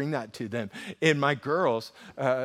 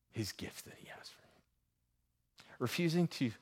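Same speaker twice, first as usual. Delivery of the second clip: hushed appeal; 3 semitones lower, 8 dB quieter than usual. Real, not acted. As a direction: let it fall away, almost breathless. Pastor drops to hushed appeal.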